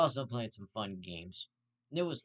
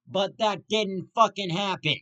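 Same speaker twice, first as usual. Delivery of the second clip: louder, higher and slower, real outrage; furious outburst; 7 semitones higher, 8 dB louder than usual. Real, not acted. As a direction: blow up at the result. Ranting about Tyson losing.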